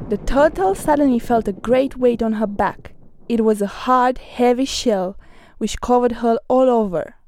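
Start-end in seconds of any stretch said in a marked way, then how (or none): none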